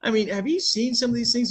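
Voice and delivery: ordinary talk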